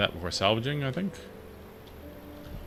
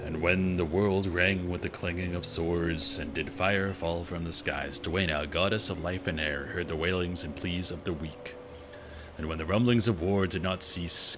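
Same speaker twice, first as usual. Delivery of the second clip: solemn lore reading; 3 semitones lower than usual. Acted. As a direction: read it like an ancient scripture, unhurried. Reads in-game lore scroll aloud.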